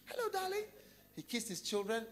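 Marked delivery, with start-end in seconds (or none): 0.1-0.6 sweet husband. Acted. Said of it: "he saw his wife".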